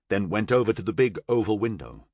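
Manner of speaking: plain reading voice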